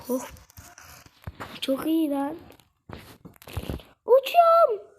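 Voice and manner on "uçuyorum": excited. Real, not acted. Excited flying.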